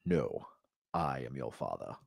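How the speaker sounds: low imitated villain reveal; mock Darth Vader. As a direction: breathe it out like the dark lord.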